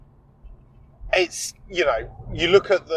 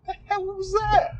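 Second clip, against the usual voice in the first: high-pitched incredulous questioning; rattled and high after a fright; real agitated bafflement; 3 dB quieter than usual. Real, not acted. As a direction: wonder aloud in disbelief, voice shot up. Still baffled by the stone strike.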